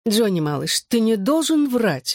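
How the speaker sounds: slow, rising motherly chiding; a mild, drawn-out sigh of reproach. A narrator's reading. Mother chiding her groggy son for lying, sighing. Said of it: "вздохнула мама".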